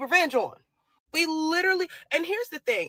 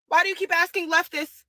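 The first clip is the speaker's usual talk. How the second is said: ordinary delivery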